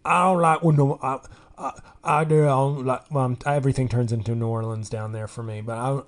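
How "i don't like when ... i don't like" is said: loud, stumbling mock Mississippi accent. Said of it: "some guy from Mississippi was like".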